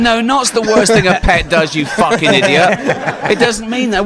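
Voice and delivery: mock-scolding a baffled friend, laughing; exasperated scolding. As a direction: scold him through laughter, incredulous. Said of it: "I went".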